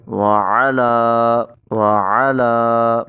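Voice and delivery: وعلا with the ع from the throat and the لا held long; slow drawn-out recitation. Shown as a demonstration. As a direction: stretch the لا in وعلا slowly. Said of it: "عین کو حلق سے پڑھیں گے". (لام کو لمبا کریں)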